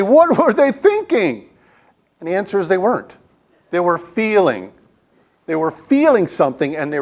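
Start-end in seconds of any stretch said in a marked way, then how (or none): 0.0-1.5 high, loud incredulous outcry; mock-appalled and pitched way up; exasperated disbelief. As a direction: stretch it out, baffled at their stupidity. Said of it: "And you say".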